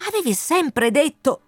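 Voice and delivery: high, urgent protest; earnest Wind figure. Acted. Wind swears to the colonel he knew nothing, earnest protest.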